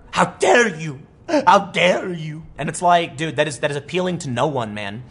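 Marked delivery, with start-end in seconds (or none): 0.1-2.5 a light mocking imitation of the young activist, the second one drawn out slow; mock her outrage, stretching the repeat slowly; mock Greta Thunberg. Mocking the activist on a news segment, in a lightly imitated indignant voice. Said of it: "Greta Thunberg?".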